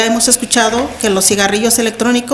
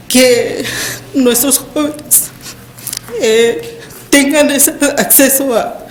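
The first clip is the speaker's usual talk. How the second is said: holding back tears. Real, not acted. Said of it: "I'm trying not to cry".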